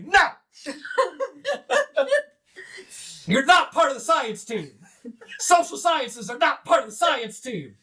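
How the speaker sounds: high, laughing gnome scolding; high mocking refusal through laughter; gnome rebuff. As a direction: scold the outsider while cracking up. Gnomes reject the psychologist.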